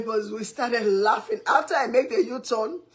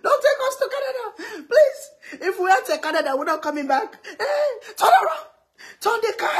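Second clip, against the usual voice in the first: high-pitched mock screams of begging; imitated panicked screaming; 12 semitones higher, 4 dB louder than usual. Two passengers beg the driver to turn back, mock panicked screaming. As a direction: plead frantically, shrieking like terrified passengers. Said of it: "they started screaming".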